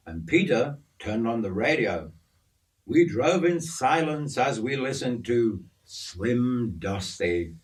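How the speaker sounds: ordinary delivery, nothing marked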